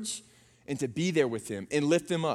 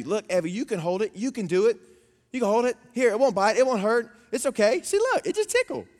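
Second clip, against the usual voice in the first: higher and higher, eager coaxing; imitated encouraging child; 5 semitones higher than usual. Iris talking Evie into holding the frog, high-pitched encouragement. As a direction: coax a frightened friend, pitch climbing. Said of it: "She takes him to Evie".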